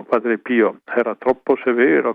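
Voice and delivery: normal talking voice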